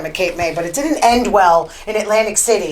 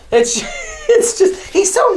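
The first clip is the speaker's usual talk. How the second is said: laughing; 13 semitones higher, slower than usual. Real, not acted. Laughing at the clip.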